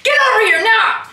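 loud, high playful shout. Real, not acted. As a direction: yell for her to come now. Shouting at mother.

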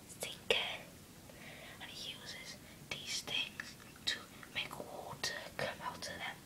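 hushed whisper so parents won't hear. Real, not acted. To viewers while parents are downstairs, whispering. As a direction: keep it breathy and secret, barely voiced. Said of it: "I have to be quiet 'cause my mom and dad are downstairs".

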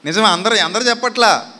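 usual speaking voice

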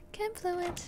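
playful high mock voice; squeaky silly voice. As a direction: chirp the item name in a cartoon voice. Repeats item name in odd voice.

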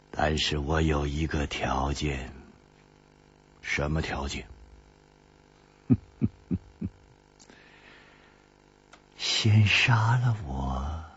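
a deep, measured crone; old woman's voice. A narrator's reading. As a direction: speak as the grim old woman, low. Old woman states her condition.

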